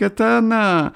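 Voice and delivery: very high cry of recognition; high-pitched exclaiming; agitated outcry. A narrator's reading. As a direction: shoot the pitch right up in agitation. Lariosik's outcry continues after telegram.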